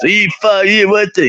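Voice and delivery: high mock tough-guy voice. Mimicking the singer busting his balls, high mock voice. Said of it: "he talk like this".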